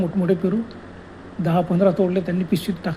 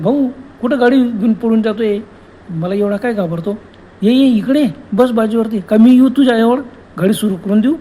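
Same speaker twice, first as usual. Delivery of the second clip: louder, higher mocking ghost woman; ghost woman calling; 3 semitones higher, 6 dB louder than usual. Ghost woman mocking Prabhakar at his bike, loud and high. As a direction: call him back with eerie cheer. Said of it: "त्यानं".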